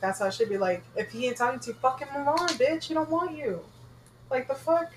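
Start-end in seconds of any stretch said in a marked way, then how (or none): 1.7-4.9 higher-pitched, dismissive retold put-down; mock sassy telling-off. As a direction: act out telling a rival to move on. Voicing blunt advice to a rival.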